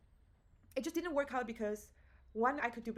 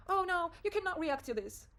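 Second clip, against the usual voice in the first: higher-pitched mild scolding; mock telling-off; 7 semitones higher, 3 dB louder than usual. Telling her caged anger to behave, mild mock scolding. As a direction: forbid it, as if scolding a pet. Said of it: "I told the anger, like".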